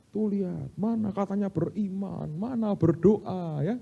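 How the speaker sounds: high, slow, drawn-out mocking; mock scornful taunt. Onlookers scorning a poor believer, mocking taunt. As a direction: imitate people sneering at someone's faith. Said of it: "Ngomongnya begitu kan".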